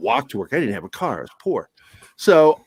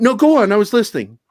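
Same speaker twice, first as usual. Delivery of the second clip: fake innocent reassurance; 6 semitones higher, 5 dB louder, faster than usual. Fake attentive, teasing.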